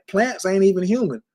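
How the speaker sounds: ordinary delivery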